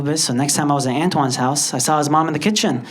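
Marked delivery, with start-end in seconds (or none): none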